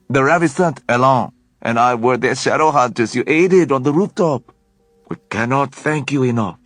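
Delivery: a grateful Frenchman pumping his hand; effusive French accent. A narrator's reading. Leon thanking Alec for the rescue, gushing French accent. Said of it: "a French accent".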